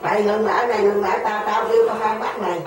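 loud, insistent cry to stop; retold yelling. Passenger retelling her yells at the cyclo driver, loud shouting. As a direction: yell at the driver to halt, retelling it. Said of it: "tôi la quá trời".

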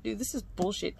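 normal talking voice

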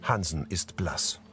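usual speaking voice

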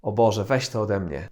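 disgusted. Shown as a demonstration. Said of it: "Jakieś zniesmaczenie".

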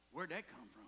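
ordinary talk

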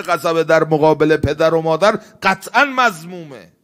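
loud, high sermon on anger; impassioned preacher building to a peak; full-force preaching. Acted. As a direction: thunder it from the pulpit.

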